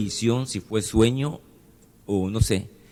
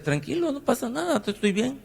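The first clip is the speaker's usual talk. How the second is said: much higher and softer, soothing the doctor; retold reassurance; 12 semitones higher, 5 dB quieter than usual. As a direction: lift the voice and hush it to calm him. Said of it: "Y yo le decía".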